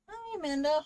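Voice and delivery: bright, loud, high hello to a viewer; cheery greeting. Real, not acted. Streamer calling out hi to a chat viewer, bright and loud. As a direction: greet her like a friend just walked in.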